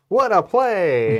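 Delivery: loud, drawn-out sportscaster call; mock commentator voice. Player imitating friend's commentary, big announcer call. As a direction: imitate a commentator hailing a great move. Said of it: "in his commentator voice".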